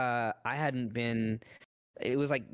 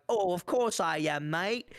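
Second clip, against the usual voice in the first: put-on English accent, higher pitch; 7 semitones higher than usual.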